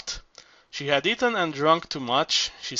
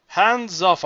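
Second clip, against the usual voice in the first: a coy, louder simpering hands off; 5 semitones higher, 6 dB louder than usual. Acted. Coy old singer simpering at Zorba's hands. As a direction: fend him off with a simper. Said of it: "Our good lady simpered".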